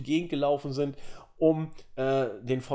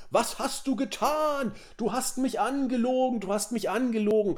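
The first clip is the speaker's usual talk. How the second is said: outraged accusing; 7 semitones higher than usual. Retold or imitated. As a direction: play the betrayed wrestler, wailing. Bryan voiced yelling at Rowan.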